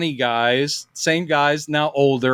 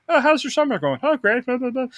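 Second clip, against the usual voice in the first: high casual chit-chat; lightly voiced pals chatting; 9 semitones higher than usual. Celebrities chatting like old pals, high casual voices. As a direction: breeze through small talk.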